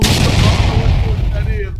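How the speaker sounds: loud, slow hype yell; excited shout. Real, not acted. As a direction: shout it out in disbelief. Hyped reaction, calling for bomb.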